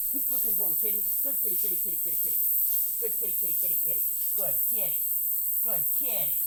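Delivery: soft, murmured kitty calling; coaxing cat. Real, not acted. Urging the cat to keep hunting, soft coaxing. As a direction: gently egg the cat on.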